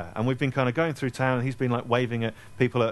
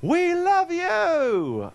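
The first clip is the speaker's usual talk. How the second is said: high, drawn-out yell from a fan in the crowd; stretched, high-pitched fan cry; distant fan shout; 3 dB louder, much slower than usual. Retold or imitated. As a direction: call it out long and high like a fan far off. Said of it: "lone voice from by the side".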